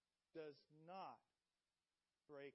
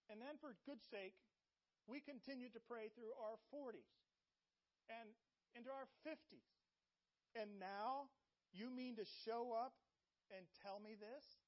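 exasperated rising protest; 5 semitones higher, 4 dB louder than usual. Acted. Old priest protesting to the angel, rising exasperation. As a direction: voice climbs higher and louder in disbelief. Zechariah's exasperated complaint grows.

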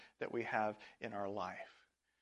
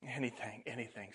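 softer, quick anything anything; softer eager reply; 7 dB quieter than usual. A narrator's reading. Healed leper answers Jesus, softer eager voice. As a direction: answer keenly but softly. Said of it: "said the man".

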